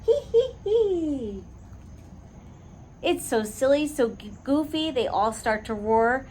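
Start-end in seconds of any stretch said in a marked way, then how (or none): 0.1-1.4 high, slow hee hee giggle; giggling. A narrator's reading. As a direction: giggle helplessly. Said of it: "and giggling".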